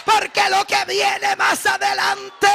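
fiery Spanish preaching; preaching at full force. Acted. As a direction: pour it out like a revival sermon.

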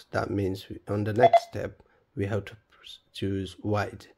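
ordinary talk

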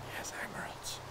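breathless hushed whisper; awed whisper. Real, not acted. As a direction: whisper it like a secret treasure. Whispered repeat of discovery.

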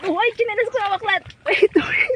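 panicked high-pitched yelps; scared, begging everyone to wait; hanging on for dear life. Real, not acted. Crossing water, slipping, losing grip. (takot na sigaw habang kumakapit)

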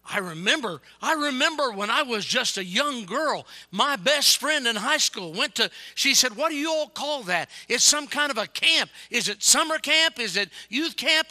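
loud, high, impassioned preacher's imitation; emotional retelling. Woman recalling her friend's youth camp, emotional and shouted. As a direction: relive her memory at full pulpit volume. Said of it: "she got really emotional".

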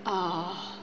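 ordinary talk, nothing marked

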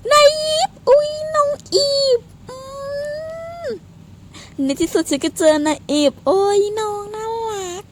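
high, whiny gushing over a cute character; fangirl gushing. Real, not acted. Streamer gushing at the cute character she must hunt, squealing. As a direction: coo like a smitten fan.

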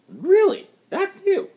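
high, incredulous disbelief; can't believe how few; astonished. Acted. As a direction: voice jumps up in amazement. Doctor astonished by alien DNA.

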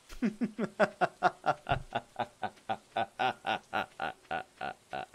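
long staccato laugh running down in pitch; milk the joke with a drawn-out ha-ha-ha; exaggerated laugh. Streamer laughing at own Dragon's Dogma pun, exaggerated laugh.

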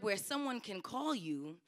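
usual speaking voice

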